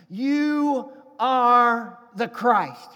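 drawn-out, weighty proclamation; slow proclaimed confession. Pretend. Peter confessing to Jesus, slow and proclaimed. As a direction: declare it slowly like a revelation.

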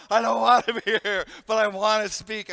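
anxious mock plea of a nervous passenger; fearful pleading. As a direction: beg to get off the plane. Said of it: "Talking about trauma".

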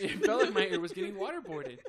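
high, loud and laughing; cracking up at your own ordeal; laughing exclamation. Real, not acted. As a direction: exclaim the absurd comparison through laughter. Laughing at own painful story.

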